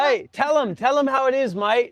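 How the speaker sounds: high, loud encouragement; eager urging. Real, not acted. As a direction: egg him on, bright and loud. Urging the kid to speak.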